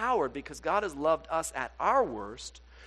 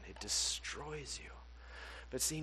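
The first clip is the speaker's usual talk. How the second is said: normal talking voice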